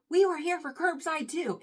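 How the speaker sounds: high-pitched indignant protest; mock yell without much volume; imitated yelling. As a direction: retort up high as the wronged driver. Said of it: "yelling at me".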